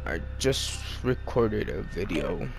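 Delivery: drained and exhausted, low energy; tired. Real, not acted. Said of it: "I'm fucking tired".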